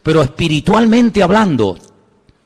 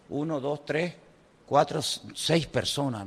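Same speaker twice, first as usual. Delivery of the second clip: counting under breath; 3 semitones lower, 15 dB quieter, slower than usual. Retold or imitated. Counting people in a queue.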